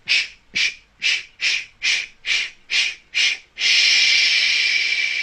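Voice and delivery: short unvoiced sh pulses, then one long sh; unvoiced "sh" bursts on one breath; sh breathing drill. Shown as a demonstration. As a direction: puff out rhythmic shushes, ending on a long one. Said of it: "same thing now to a sh sound".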